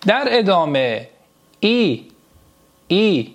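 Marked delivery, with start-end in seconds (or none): 1.6-3.3 «ای» as a clear "ee"; dotted i sound. Shown as a demonstration. Teacher models dotted i for students, clear "ee". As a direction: say «ای» as a close front "ee", twice.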